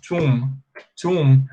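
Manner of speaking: tomb said toom with no B; tomb as toom, no B sound; silent B. Shown as a demonstration. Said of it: "Você fala desse jeito".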